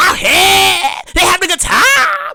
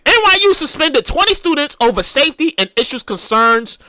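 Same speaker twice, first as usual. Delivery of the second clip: ordinary talk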